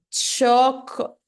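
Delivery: Choco- drawn out loudly, CHO-ko; every syllable pronounced. Shown as a demonstration. Teacher mimics learners' need for every syllable, loud drawn-out Choco-. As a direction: stretch each syllable of Choco-, Brazilian style. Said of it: "cada sílabazinha".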